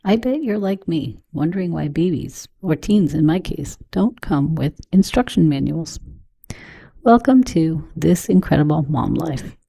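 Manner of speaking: upbeat host read over music; podcast intro read. Acted. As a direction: sell the show like a polished promo.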